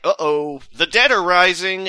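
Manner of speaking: hammed-up spooky alarm; mock dramatic alarm. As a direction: ham up the sudden danger. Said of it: "suddenly it's like".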